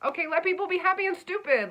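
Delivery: high, loud and fast, fed up; exasperated outburst. Acted. Reader scolding earth signs, loud exasperated outburst. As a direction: blurt it out, throwing up your hands.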